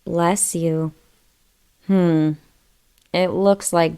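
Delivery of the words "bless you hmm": ordinary delivery, nothing marked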